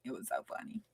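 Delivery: fading into a whisper after laughing; barely voiced, worn out from laughing; whispered afterglow of a laugh; breathless whisper. Real, not acted. Laugh fading after funny story.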